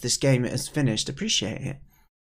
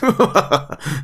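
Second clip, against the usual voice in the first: loud, higher laugh; character's gloating laugh; 5 semitones higher, 8 dB louder than usual. Acted. Old pike enjoying his dark joke, loud laugh. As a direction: laugh loudly at your own dark joke.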